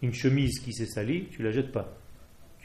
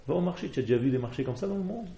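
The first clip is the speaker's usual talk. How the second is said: usual speaking voice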